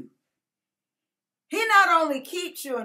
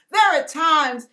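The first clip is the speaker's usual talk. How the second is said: usual speaking voice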